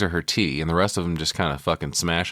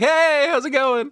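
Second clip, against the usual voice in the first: much higher, louder, slow sing-song hello; breezy cheer of a blissed-out guy; overly happy greeting; 5 dB louder, slower than usual. Retold or imitated. Blissed-out stranger greeting tripping friends, high and sing-song. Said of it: "and he's like".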